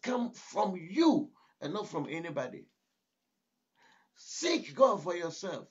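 rising, emphatic preacher's cadence with punched words; preaching. Acted. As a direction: drive each line home like a pulpit exhortation. Preacher exhorting his audience.

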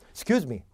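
clipped-off first syllable in Excuse me; only KYU audible. Shown as a demonstration. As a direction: Excuse me reduced to 'skyooz me. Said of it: "Q만 들리면".

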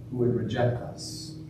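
normal talking voice

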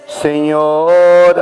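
ordinary talk

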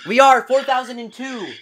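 high, loud cheer; excited shout. Real, not acted. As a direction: shout the milestone. Celebrating follower count.

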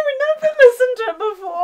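laughing. Real, not acted. Laughing about their song.

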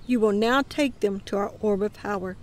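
plain reading voice

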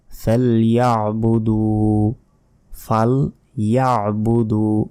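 ফালইয়াবুদু slowly, "ya'" caught in the throat; measured recitation, sounding the ain in ফালইয়াবুদু; clear throaty ain. Shown as a demonstration. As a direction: stretch the final "duu" of ফালইয়াবুদু. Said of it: "সঠিক উচ্চারণটি হচ্ছে".

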